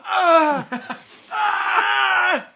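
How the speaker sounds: high, loud laughing yelp; laughing shout. Real, not acted. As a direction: burst out with a loud high yell. Laughing yelp at being teased.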